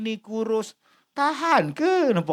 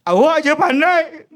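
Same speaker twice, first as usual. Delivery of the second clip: higher, louder snap back; sulky retort; 6 semitones higher, 5 dB louder than usual. Retold or imitated. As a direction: throw the jibe back at him. Wife snaps back. (isteri membalas dengan suara tinggi dan kuat)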